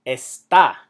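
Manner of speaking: "está" slowly as es-TA; slow "está", weight at the end; stress on last syllable. Shown as a demonstration. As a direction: stretch and punch the -tá of "está". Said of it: "It's pronounced está".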